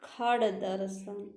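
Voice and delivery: खड़ दर्शन spoken slowly, each part clear; teacher correcting खड़ दर्शन; slow careful pronunciation. Shown as a demonstration. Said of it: "होता क्या है".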